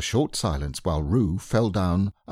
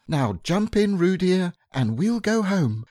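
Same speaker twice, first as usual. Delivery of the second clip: high, gentle mother kangaroo voice; high motherly Kanga; 12 semitones higher than usual. A narrator's reading. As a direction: coax like a fond mother kangaroo.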